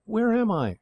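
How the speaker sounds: a sudden high cry on waking; startled waking cry. A narrator's reading. Girl waking on horseback asks in alarm, high and startled. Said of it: "She gave a little cry".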